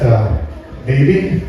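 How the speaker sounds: ordinary talk, nothing marked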